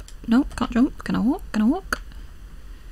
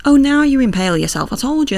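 loud, exasperated, higher pitch; exasperated yell; 5 semitones higher, 6 dB louder, faster than usual. Real, not acted.

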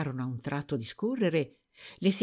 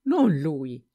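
louder, urgent denial; protesting; 6 dB louder, much slower than usual. A narrator's reading. Servant denying to her master, louder protest. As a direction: push back hard. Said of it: "Ella protestò".

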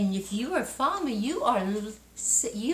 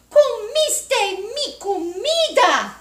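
high, loud, slow whiny wail; angry baby bear; 12 semitones higher, 9 dB louder, slower than usual. Acted. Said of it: "gritó bebé osito". (osito enojado gritando)

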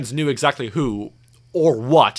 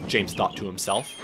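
ordinary delivery

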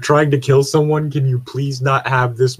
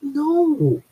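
sharply higher no; indignant no; 14 semitones higher than usual. Real, not acted. Host denies liking the film, high and indignant. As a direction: refuse it in outrage.

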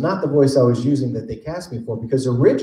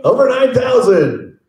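over nine thousand; 11 semitones higher, 5 dB louder, slower than usual. Pretend. Dragon Ball meme quote.

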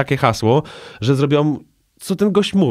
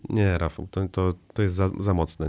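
soft, lowered voice of a doubtful friend; hesitant disapproval; 4 semitones lower, 8 dB quieter than usual. Acted. Imitating a buddy rejecting his joke, hesitant and quiet. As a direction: gently act out turning a joke down, low and halting.

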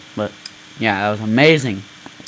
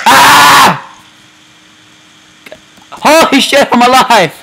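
loud, much higher shout of disbelief; relieved yell; 11 semitones higher, 8 dB louder, slower than usual. Real, not acted. Gamer surviving a crash, loud relieved yell.

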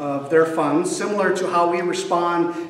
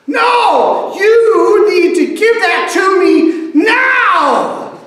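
put-on high, loud shouting toddler, slowed and bossy; child tantrum; 14 semitones higher, 8 dB louder, much slower than usual. Boy screaming at his mom to hand him the truck, high loud tantrum. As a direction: order her like a furious little boy.

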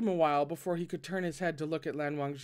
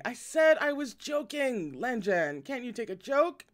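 yelping in pain; 7 semitones higher, 4 dB louder than usual. A narrator's reading. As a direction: yelp it as your wrist is twisted. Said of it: "yelped incessantly".

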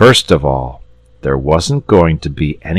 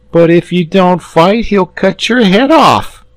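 put-on high, loud frightened boy; boy gasping in fear; 6 dB louder than usual. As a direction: blurt it in alarm. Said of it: "gasped the boy".

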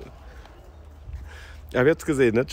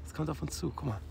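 whispering; 13 dB quieter than usual. Real, not acted. Hushed as a boat comes near.

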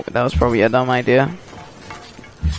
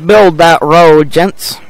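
commanding foreman call; 7 dB louder, slower than usual. Pretend. Ordering workers in game.

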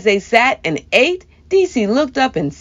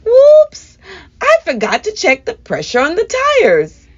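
surprised whoops; 10 semitones higher, 4 dB louder than usual. A narrator's reading. Grandma realizes tire problem.

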